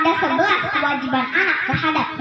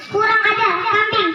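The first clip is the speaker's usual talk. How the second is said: higher mock scolding shout; angry mother cursing; 5 semitones higher than usual. Mother scolding her child again, mock angry. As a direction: curse the kid in mock fury.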